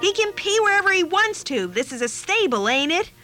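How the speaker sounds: high, indignant comeback; mad retort. A narrator's reading. Girl playing Mary defending the shepherd, angrily. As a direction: fire back, indignant. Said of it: "That made me mad".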